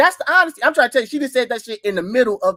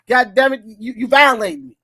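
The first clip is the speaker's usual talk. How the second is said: usual speaking voice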